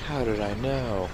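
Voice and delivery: hushed, feigned astonishment; softly hammed-up surprise; mock amazement. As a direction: drop to a near-whisper in pretend wonder. Feigned amazement at predicted elevator.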